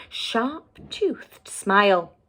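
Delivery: usual speaking voice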